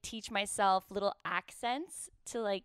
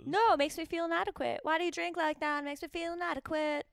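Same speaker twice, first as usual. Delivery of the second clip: high, insistent reciting of lyrics; exasperated high-pitched repeat; insistent correction; 8 semitones higher than usual. Real, not acted. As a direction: spell out the lyric in a raised pitch. Repeats lyric listener took for noises.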